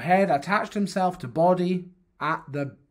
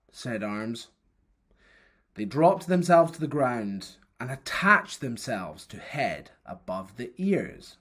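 usual speaking voice

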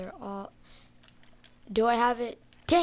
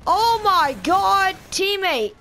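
slow, drawn-out outraged shout, much higher and louder; exasperated yell; 9 semitones higher, 9 dB louder, slower than usual. Real, not acted. Gamer scolding his teammate, slow exasperated yell. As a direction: wail it at a useless teammate.

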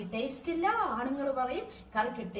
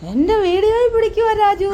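teasing call; 8 semitones higher, 16 dB louder than usual. Real, not acted. Teasing husband resting, calling loudly.

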